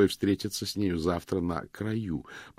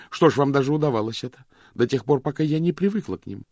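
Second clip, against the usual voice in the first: bitter tirade; 7 semitones higher, 5 dB louder than usual. Acted. Vivien bitter accusing tirade.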